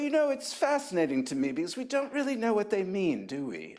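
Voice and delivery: lightly imitating a mid-Atlantic editor; mid-Atlantic accent. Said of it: "he had this wonderful mid-Atlantic accent".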